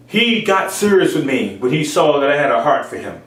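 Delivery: normal talking voice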